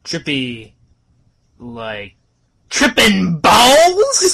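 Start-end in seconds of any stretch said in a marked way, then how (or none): 2.7-4.3 high, slow, squealing; trippin' balls shriek. Acted. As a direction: squeal it out with glee.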